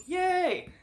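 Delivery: high happy yay; excited cheer. Real, not acted. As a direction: cheer it out brightly.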